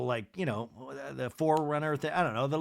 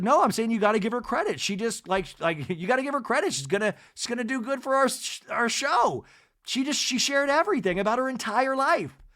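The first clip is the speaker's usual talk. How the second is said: higher, hurried pleading of a case; imitated protest, pitched up; insistent arguing; 8 semitones higher, 3 dB louder than usual. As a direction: argue back in a raised pitch, stumbling over words. Acted Angie arguing back.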